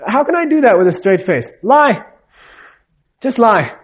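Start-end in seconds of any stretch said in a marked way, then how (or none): 1.6-3.7 higher, curt command; blunt order. Retold or imitated. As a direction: snap the order at him, lightly acted. Boss orders the employee to lie.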